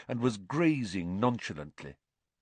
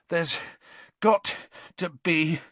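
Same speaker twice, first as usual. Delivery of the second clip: out of breath from climbing stairs; panting; 7 semitones higher, 4 dB louder, slower than usual. A narrator's reading. Ron climbing the seventh long staircase, panting. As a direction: gasp it out in bursts, higher, with pauses. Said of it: "Ron panted as they climbed".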